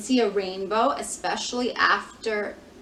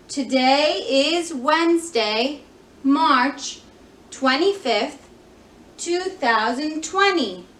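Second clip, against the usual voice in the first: put-on louder singsong for small children; broad singsong kids theatre; 4 semitones higher, 6 dB louder, slower than usual. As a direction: lead the little ones in saying it. Children repeat the date.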